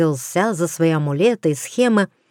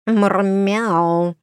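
cat meow. A narrator's reading.

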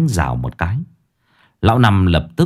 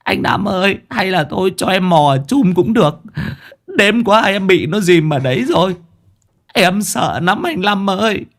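high-pitched, tearful begging; whimper, drawing out the last words; terrified whining plea; 11 semitones higher than usual. Acted. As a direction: wail to the boss in fear. Coward begs not to enter pond.

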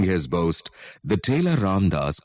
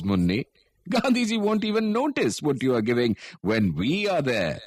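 a touch higher, cheerful townsman boasting of donations; lightly voiced villager; 7 semitones higher than usual. Villager reassuring old Munni about the donations, lightly voiced.

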